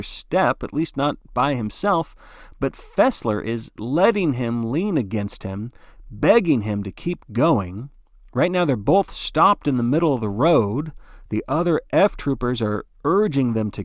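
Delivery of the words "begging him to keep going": ordinary delivery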